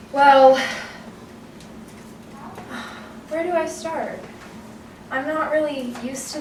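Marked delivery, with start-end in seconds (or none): 0.6-3.2 long breathy nervous sigh; heavy sigh. Acted. Red starting her story at group therapy, heavy sigh. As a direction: let out a long, soft sigh.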